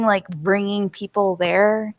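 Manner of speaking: normal talking voice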